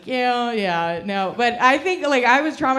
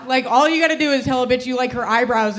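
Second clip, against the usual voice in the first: ordinary delivery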